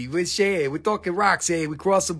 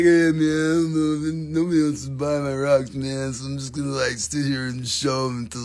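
bored mopey self; 6 semitones lower than usual. Retold or imitated. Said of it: "and I was like".

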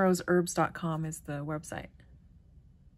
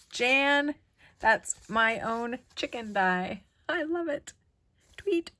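excited; 6 semitones higher, 4 dB louder, slower than usual. Real, not acted. Thrilled by chicken stamp.

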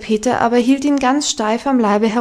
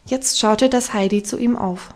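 plain reading voice

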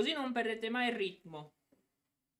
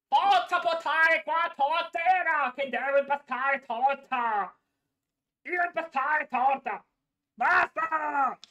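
loud, bossy fake German accent; 4 dB louder than usual. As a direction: bark orders in German accent. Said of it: "adesso inizia a fare pure tedesco".

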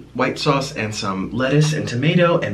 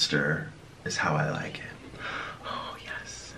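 whispering; 10 semitones lower, 13 dB quieter, slower than usual. Real, not acted. Quiet savoring aside while eating.

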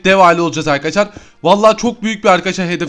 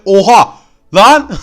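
sudden loud startled cry, pitch jumping up; startled shout; 6 dB louder, much slower than usual. Real, not acted. Gamer startled by a sudden mob, loud shocked cry. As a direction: yelp in shock.

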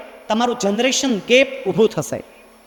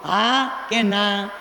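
drawn-out question; 2 semitones lower, much slower than usual. Acted. Asks the parents to answer.

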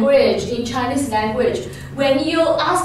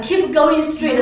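normal talking voice

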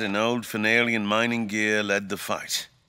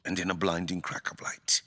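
softer, a whispered telling; hushed whisper; 6 dB quieter than usual. Acted. King recounting the uncle's death to the doctor, hushed. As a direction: lean in and whisper it.

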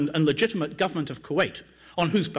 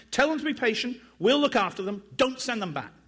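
raised pitch, urgent call to the men; narrated yell; 8 semitones higher than usual. A narrator's reading. Sergeant ordering his men to help, a mild yell. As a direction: keep calling out, a touch urgent. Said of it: "he yelled at the soldiers".

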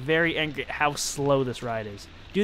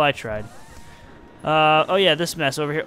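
normal talking voice

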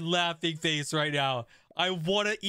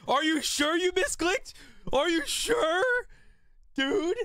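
can't believe it, push higher; incredulous; 11 semitones higher than usual. Real, not acted. Incredulous at chatter's misclick.

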